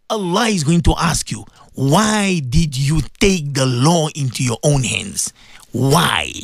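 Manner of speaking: slow, low-pitched solemn questioning; grave judgment. Acted. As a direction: question like a judge, low and deliberate. Voices God's question on judgment day.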